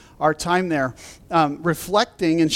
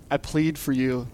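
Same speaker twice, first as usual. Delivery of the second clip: softer, slow pleading; earnest plea; 4 semitones lower, 6 dB quieter, slower than usual. Real, not acted. Pastor pleading with his congregation, softer and slow. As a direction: plead gently.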